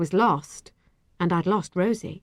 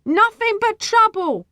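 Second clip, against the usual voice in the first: high, loud, slow parting shot; piercing, scornful cry; shrieking; 6 dB louder than usual. A narrator's reading. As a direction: shriek it after someone leaving. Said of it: "she shrieked after me".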